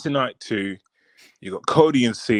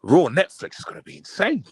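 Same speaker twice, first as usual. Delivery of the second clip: mock excited fan gasping at the news; imitating hyped fan; 6 semitones higher, 4 dB louder than usual. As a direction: play the eager fan imagining the show. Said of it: "And then you're thinking".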